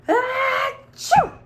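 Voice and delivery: loud high mock sneeze; an enormous hammed-up achoo; big sneeze. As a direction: giant sneeze blown out. Story sneeze acted out.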